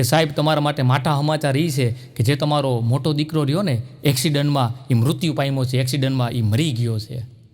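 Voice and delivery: low, grave storytelling voice; deep, earnest closing of a comedy show; solemn tribute. Acted.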